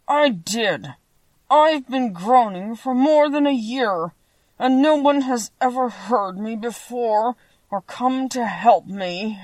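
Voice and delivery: slow, drawn-out lament of a tin man; mildly mournful, each word stretched out; sad voice. A narrator's reading. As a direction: plead slowly, as one long unheard. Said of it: "moved by the sad voice in which the man spoke".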